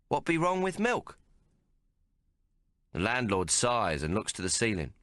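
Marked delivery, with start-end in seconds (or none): 0.1-1.2 high, wide-eyed innocent question; mock innocent. A narrator's reading. Tomo plays dumb to the landlord, innocently. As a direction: play the innocent bumpkin. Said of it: "all innocent".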